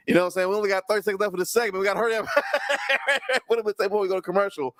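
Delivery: mock panic about the clock, rattled off quick; rushed wrestler voice. Voicing Trick, rushed for commercial.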